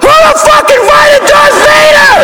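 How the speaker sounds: very high, panicked screech; shrieked, comically high outrage; horrified kid. Retold or imitated. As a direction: scream it like a terrified child to his mom. Horrified kid turning to his mom.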